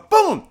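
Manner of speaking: sudden loud high shouted boom; explosion noise. Retold or imitated. Comic ending the gremlin microwave story, shouted boom sound. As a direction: blow up with a yelled boom. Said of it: "he's going".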